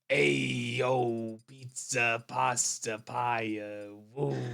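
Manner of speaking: hammed-up New York pizza-guy drawl; mock New York Italian accent; exaggerated New York Italian accent. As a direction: put on a cartoon Italian-American accent. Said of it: "no one talks like".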